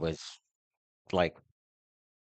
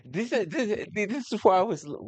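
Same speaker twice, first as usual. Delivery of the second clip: mock outraged voice; 12 semitones higher than usual.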